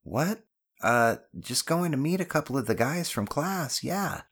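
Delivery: put-on high-pitched, evasive boy; boy fumbling for a cover story; caught-out boy. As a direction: improvise an excuse in a high voice. Voices the caught son.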